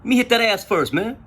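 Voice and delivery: high-pitched teasing, breaking into a laugh after; joking. Retold or imitated. Speaker messing with a jail acquaintance, joking. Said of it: "I'm just fucking around with him".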